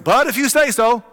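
half-sceptical but game, pushed up loud; a retold Simon calling out his go-ahead; eager fisherman. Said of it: "so Simon's like".

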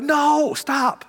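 high, loud sudden stop; alarmed no. Retold or imitated. Pastor acting himself stopping the workaholics, high and loud. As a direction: cut them off in alarm. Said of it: "You're like".

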